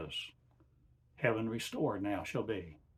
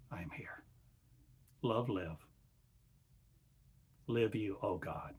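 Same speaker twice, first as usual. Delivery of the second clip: channeled divine voice. Acted. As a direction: speak as God through a man, measured and calm. Channeling God in slow sermon.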